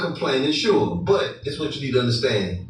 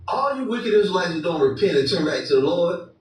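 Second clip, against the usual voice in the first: ordinary talk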